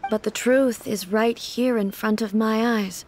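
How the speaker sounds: ordinary talk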